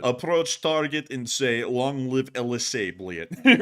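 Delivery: slow, deadpan agent reading orders; mock spy orders. Russian agent repeating instructions, slow mock deadpan. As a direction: recite it like a secret briefing.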